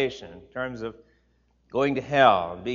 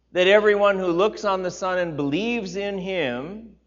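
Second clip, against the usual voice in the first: louder, raised preaching read; scripture reading; 3 semitones higher, 6 dB louder than usual. Acted. Pastor reading Jesus' words aloud to the church, raised and solemn. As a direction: proclaim the verses to the congregation.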